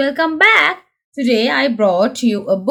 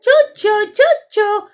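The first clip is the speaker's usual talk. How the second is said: George crying choo-choo; 8 semitones higher than usual. Acted. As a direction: sing it out like a delighted toddler. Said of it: "cries George".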